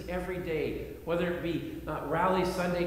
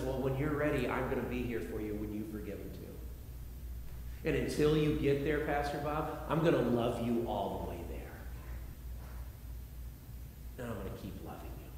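tender voice of God; 8 semitones lower, 5 dB quieter than usual. Retold or imitated. God reassures pastor, softly.

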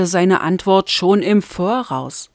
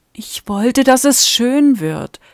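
plain reading voice

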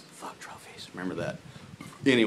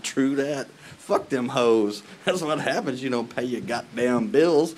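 gloating; 3 semitones higher, 6 dB louder, slower than usual. Retold or imitated. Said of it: "I was all like".

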